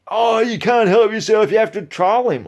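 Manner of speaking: mocking whiny commenters. Mocking the commenters who jump on his posts, in a high jeering voice. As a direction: mimic the pile-on crowd with scorn. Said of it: "you'd have frickin' ten people jump on it".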